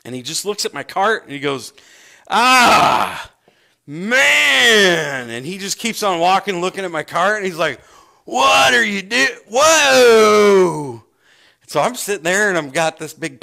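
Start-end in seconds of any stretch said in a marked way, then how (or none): 2.3-5.3 loud, high drawn-out wail of disbelief; aghast exclamation. Retold or imitated. Shopper reacts to loaded cart. 8.3-11.0 very loud, high drawn-out whoa; astonished shout. Retold or imitated. Shopper gawks at the full cart.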